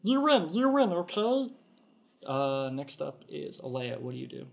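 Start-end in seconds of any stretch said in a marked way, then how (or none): 0.0-1.5 much higher, louder, frantic surrender; surrendering kobold. Acted. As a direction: squeal out the surrender. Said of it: "he goes".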